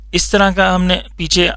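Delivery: ordinary delivery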